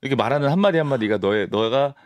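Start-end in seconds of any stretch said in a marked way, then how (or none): none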